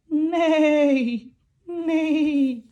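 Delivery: whinny like a playful horse; falling neigh for the kids. Pretend. Horses in farm rhyme.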